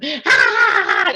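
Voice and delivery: high, loud mock belly laugh; imitated roaring laughter. Nurse laughing at her own joke, imitated loud cackle. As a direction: burst into the nurse's roar of laughter. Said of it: "roars with laughter".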